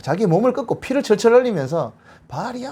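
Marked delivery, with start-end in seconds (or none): 2.3-2.7 high, hushed imitation of a desperate cry; mock frantic plea. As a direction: wail it thinly, as a small-scale mock invocation. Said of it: "하면서 막 난리". (높고 작은 소리로 흉내 낸 절박한 외침)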